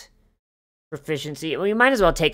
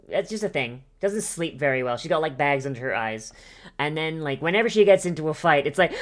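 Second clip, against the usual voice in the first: ordinary talk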